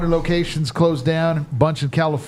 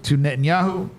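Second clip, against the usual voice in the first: ordinary talk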